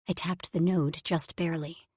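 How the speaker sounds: ordinary delivery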